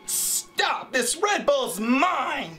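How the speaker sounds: high, drawn-out bitchy protest. Acted. Guarding a Red Bull from someone taking it, bitchy. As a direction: stretch out the last words, possessive and bitchy. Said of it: "more just bitchy".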